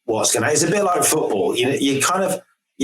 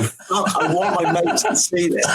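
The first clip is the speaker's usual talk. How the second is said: laughing, a bit higher, star-struck; barely holds in a laugh; excited, laughing; 4 semitones higher than usual. Retold or imitated. Lunching with a football star, thrilled and laughing. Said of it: "sitting there going".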